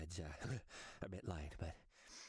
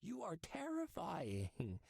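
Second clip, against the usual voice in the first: incredulous; 13 semitones lower, 8 dB louder than usual. Acted. Incredulous flattery.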